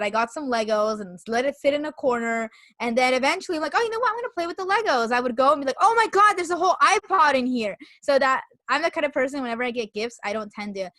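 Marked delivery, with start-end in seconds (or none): 3.8-5.1 imagined cheerful self. Pretend. 5.8-7.8 much higher and louder pretend shock; mock surprise. Imagined discovery voiced.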